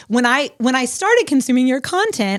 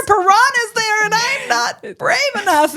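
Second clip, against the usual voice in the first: much higher, small frightened voice; mock scared; 12 semitones higher, 4 dB louder than usual. Host retelling her answer to the Lord, mock frightened. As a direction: play your past self, timid and scared. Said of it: "And I was like".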